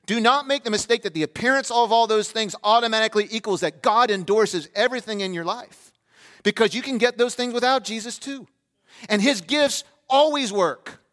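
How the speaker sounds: loud, high, emphatic sermon delivery; full-force preaching. Real, not acted. Preacher warning the church about false assurance, full-force preaching. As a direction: drive each warning home from the pulpit.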